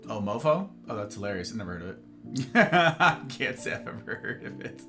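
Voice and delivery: ordinary delivery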